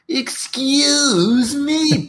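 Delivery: mock catchphrase, very high and drawn out; Link. Co-host imitating Link's catchphrase in disbelief, mocking. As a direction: stretch it out high and exasperated. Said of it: "makes you think he would go".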